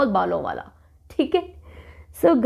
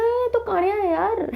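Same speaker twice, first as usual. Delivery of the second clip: high-pitched startled exclaim; surprised discovery; 10 semitones higher than usual. Acted. Customer spotting the one-eyed barber, high surprised exclaim. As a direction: jump up in pitch, caught off guard.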